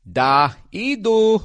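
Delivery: put-on slow, lightly done Russian accent; a hint of a Russian accent. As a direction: drawl it out like a video game Russian scientist. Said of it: "how to do any, a Russian accent".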